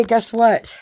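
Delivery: ordinary talk, nothing marked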